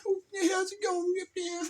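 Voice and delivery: high, goofy cat-lady greeting; mocking cat lady. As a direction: poke fun with a high character voice. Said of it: "make fun of the cat lady".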